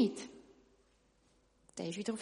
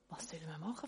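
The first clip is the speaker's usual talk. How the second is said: very soft, lower question; quiet worried question; 4 semitones lower, 11 dB quieter, much faster than usual. Acted. Tailor clinging to the bridge, quiet worried question. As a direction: ask helplessly, almost to yourself. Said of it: "fragt der Schneider".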